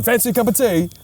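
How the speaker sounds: hammed-up British accent, high. Teasing imitation of British stranger, mock UK accent. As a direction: parody a Brit in a high voice. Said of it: "U K accent".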